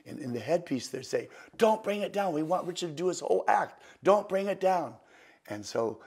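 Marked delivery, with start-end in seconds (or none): 1.6-5.0 loud, higher-pitched retold command; urgent orders. Show staff over the headpiece to Teller, urgent orders. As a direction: bark it as an urgent order down an earpiece.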